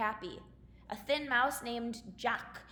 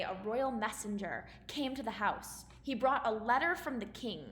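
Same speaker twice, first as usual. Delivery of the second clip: ordinary talk, nothing marked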